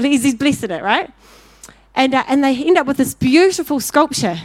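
ordinary delivery

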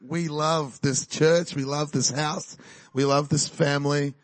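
ordinary talk

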